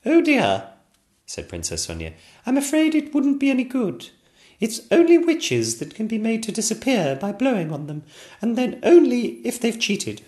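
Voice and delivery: put-on high childlike princess, apologetic; a small girl sorry to disappoint; little princess voice. Princess Sonia telling the king it won't work, high and childish.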